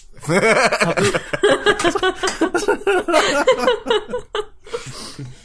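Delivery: high maniacal cackle; evil laugh. Acted. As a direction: cackle like a villain. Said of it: "supposed to be an evil laugh".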